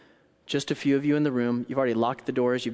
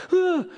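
startled scream; 7 dB louder than usual. Acted. Startled heart imitated.

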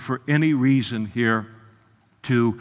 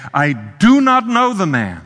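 loud, raised, emphatic denial; swearing denial; 8 semitones higher, 9 dB louder than usual. Acted. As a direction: swear it forcefully, voice lifted. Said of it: "he began to curse and to swear".

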